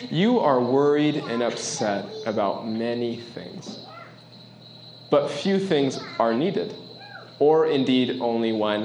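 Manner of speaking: slow, deliberate scripture read. A narrator's reading. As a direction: lay each word down slowly. Reading Jesus' words slowly.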